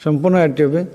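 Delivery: ordinary talk, nothing marked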